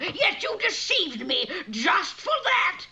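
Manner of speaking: put-on high, angry shout at the child; angry witch. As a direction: scold her furiously. Witch scolding Rapunzel.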